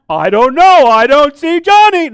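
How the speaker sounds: very loud, high singsong pretence; hide-and-seek pretending. Parent playing hide and seek with kids, loudly pretending. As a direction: exaggerate not finding the child, booming and high. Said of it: "Like when your kids play hide and seek".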